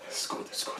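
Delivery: imitating a whisper to himself; whispered self pep talk. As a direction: psych yourself up under your breath. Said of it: "he amped himself".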